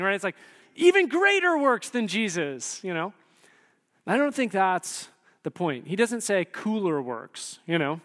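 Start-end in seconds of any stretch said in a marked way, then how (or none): 0.7-2.8 higher, louder, wowed exclamation; mock excitement. Preacher imagining miracles bigger than Jesus', hammed-up excitement. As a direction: play up being dazzled by the idea.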